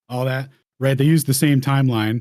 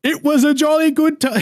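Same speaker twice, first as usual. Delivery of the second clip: mock English accent; 5 dB louder than usual. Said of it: "my best English accent".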